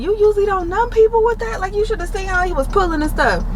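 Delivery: high, fast disbelieving question; can't believe what you're hearing; incredulous. Retold or imitated. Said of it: "I'm like".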